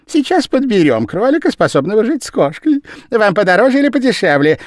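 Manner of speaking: very high, loud eager salesman. A narrator's reading. The Japanese man fawning over Madame Danton, high and fast. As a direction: fawn and rush the sale.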